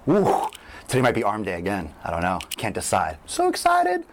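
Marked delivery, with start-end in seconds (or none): 0.1-0.5 excited woo. Real, not acted. Hype cheer at sign-off. 3.3-4.0 hammed-up excitement. Hyped sign-off to viewers.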